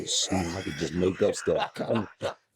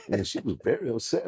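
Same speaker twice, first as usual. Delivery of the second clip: ordinary talk, nothing marked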